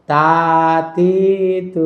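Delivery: "তা তি তু" with every vowel stretched long; wrongly drawn out. Shown as a demonstration. Teacher shows students what not to say, "তা তি তু" drawn out. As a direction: drag out each syllable of "তা তি তু". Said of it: "টান দিয়ে এখানে বলা যাবে না".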